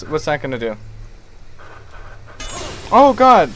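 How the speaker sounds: ordinary talk, nothing marked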